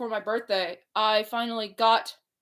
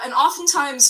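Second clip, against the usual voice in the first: ordinary talk, nothing marked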